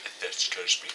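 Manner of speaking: unvoiced low aside; whispered aside. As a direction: whisper it to yourself. Muttered aside.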